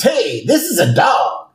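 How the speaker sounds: indignant high-pitched exclamation; Homer's indignant discovery. Acted. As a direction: blurt it out, pitch jumping up. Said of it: "Homer goes up there and he says".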